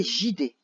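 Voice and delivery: "J D" as one word, "jidé", starting with a zh sound; soft G misreading. Shown as a demonstration. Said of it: "sans le U, ça ferait". (« J D » prononcé « jidé », avec un g doux)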